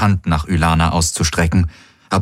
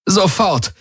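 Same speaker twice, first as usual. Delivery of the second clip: urgent trembling; 12 semitones higher than usual. A narrator's reading. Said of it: "rief sie mit zitternder Stimme".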